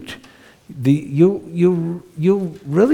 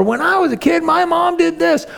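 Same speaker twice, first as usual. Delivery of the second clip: much higher busybody mom voice; judging mom. Retold or imitated. Meddling mom to a struggling parent, high mock voice. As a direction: mimic a know-it-all mother.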